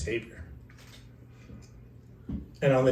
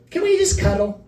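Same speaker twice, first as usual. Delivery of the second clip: sweet wife's plea; 5 dB louder than usual. Retold or imitated. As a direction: coax him out of the fight. Wife making peace mid-argument.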